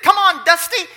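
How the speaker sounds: high, loud holler; calling to the mule. Retold or imitated. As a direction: urge the mule on with a shout.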